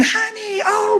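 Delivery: high, gushing mom voice; gushing mother. Retold or imitated. Wife greeting her son after the wreck, high gushing coo.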